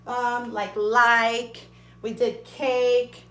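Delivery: normal talking voice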